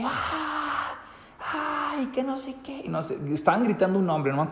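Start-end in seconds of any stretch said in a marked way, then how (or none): 0.0-2.8 high, thin mock screaming from far off; imitated distant screams. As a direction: echo the panicked cries from the bridge, much higher. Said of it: "empiezo a escuchar gritos". (imitando gritos lejanos, agudos y suaves)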